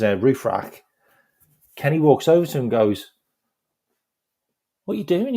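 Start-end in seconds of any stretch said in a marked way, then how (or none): 4.8-5.3 high-pitched baffled question; puzzled, pitched well up; retold bafflement. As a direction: imitate a bewildered manager. Manager questions odd scene, retold.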